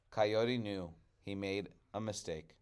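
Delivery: ordinary delivery, nothing marked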